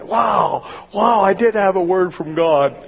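loud, high, amazed outcry; overjoyed exclamation; excited shout. Retold or imitated. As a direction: shout it in wonder.

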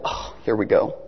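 breathy whisper of resignation; whispered dread. Retold or imitated. The pastor bracing for Bill's news, in a whisper. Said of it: "I go".

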